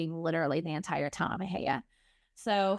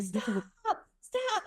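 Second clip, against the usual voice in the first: high, held-back reenacted yell; mock yell; 11 semitones higher than usual. Foster mom to the driver passing her house, high mock yell. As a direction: call out high without real volume.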